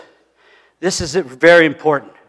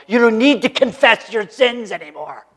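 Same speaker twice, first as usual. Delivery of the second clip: normal talking voice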